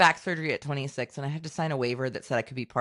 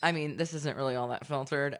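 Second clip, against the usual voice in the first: usual speaking voice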